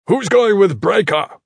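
loud, high, slow demand; commanding call. A narrator's reading. Iron Fist demands an escort for Breaker, commanding call.

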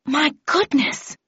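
startled mother's exclamation; exclaiming in surprise. Acted. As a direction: gasp it out at the sight of blood. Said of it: "exclaimed my mother".

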